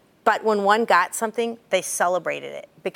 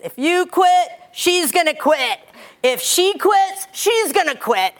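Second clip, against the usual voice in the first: loud, much higher coach's call; tough coach; 10 semitones higher, 4 dB louder, slower than usual. Retold or imitated. Strength coach calling out to athletes, loud and high. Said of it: "She walks around and says".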